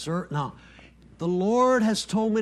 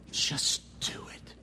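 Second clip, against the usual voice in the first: slow unvoiced whisper; hushed reverent whisper; 9 dB quieter, slower than usual. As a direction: breathe the words reverently. Quoting Mary's words softly.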